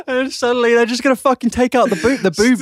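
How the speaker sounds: half-shouted through laughter; laughing. Real, not acted. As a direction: push through the giggles. Laughing outburst.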